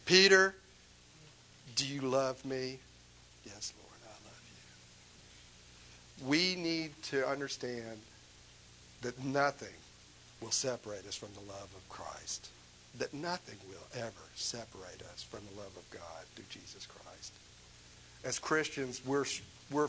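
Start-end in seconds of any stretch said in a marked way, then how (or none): 0.1-2.8 louder on Peter, then easing back; a firmer second asking; pressing question. Acted. Jesus asks again, louder. 3.4-6.3 very soft, almost fading away; quiet, humble answer; hushed reply. Acted. Peter's soft reply again. 10.4-17.3 softer, whispered promise; a hushed, intimate assurance. Real, not acted.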